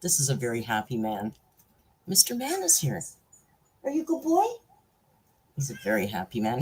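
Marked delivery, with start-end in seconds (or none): 2.1-4.4 high, cooing pet voice. Real, not acted. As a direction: coo at a beloved pet in a high voice. Baby-talking to the pet.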